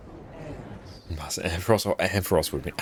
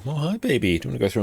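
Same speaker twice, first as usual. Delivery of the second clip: high sweet pet greeting; talking to pet; 7 semitones higher, faster than usual. Real, not acted. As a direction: coo at the dog. Greeting the dog.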